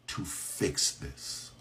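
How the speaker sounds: slow, breathy whisper, barely voiced; whispered warning. Real, not acted. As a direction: drop to a slow whisper to drive it home. Rant ends in hushed warning.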